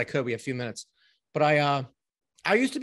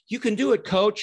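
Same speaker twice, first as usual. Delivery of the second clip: higher-pitched pep talk, lightly acted; mock cheerleader; 5 semitones higher, 4 dB louder than usual. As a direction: play an encouraging motivator for a moment. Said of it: "written in their personality style".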